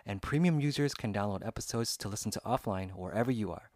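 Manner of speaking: loud, upbeat podcast ad read. Acted. Host pitching Spotify to listeners, upbeat ad read. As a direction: sell the app to listeners, punchy and bright.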